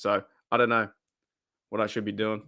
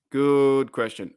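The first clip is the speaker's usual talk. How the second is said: slow drawn-out; much slower than usual. Shown as a demonstration. Streamer mock-musing over a chat question, slow and drawn. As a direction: stretch out "Good question" slowly, mock pondering. Repeats the phrase slowly.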